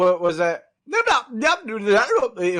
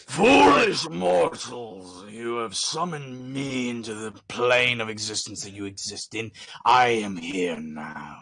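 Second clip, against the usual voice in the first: dark lord; 6 semitones lower than usual. Pretend. Summoned winged one speaks at wedding.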